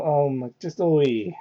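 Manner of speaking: ordinary delivery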